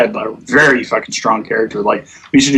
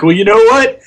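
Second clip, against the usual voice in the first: much higher, indignant mock retort; imitating defensive leader; 10 semitones higher than usual. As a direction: snap back like a miffed raid leader. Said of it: "He's like".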